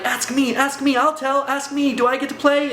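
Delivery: put-on a kid begging to join in; playful self-mockery of eagerness; eager. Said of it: "And I'm like".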